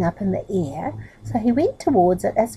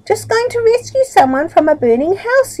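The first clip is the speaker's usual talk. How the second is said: called out high and loud over his shoulder; yelling back; 13 semitones higher, 7 dB louder than usual. Acted. Middle yells back to the farmer while walking off, loud call. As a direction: shout it back, self-important. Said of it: "yelled back Middle".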